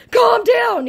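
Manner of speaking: very high squeaky mock "calm down"; mock scammer. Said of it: "he said".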